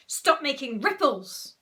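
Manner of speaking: put-on loud, bossy boy's voice; Horrid Henry. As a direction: order it forcefully, drawn out. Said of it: "said Horrid Henry".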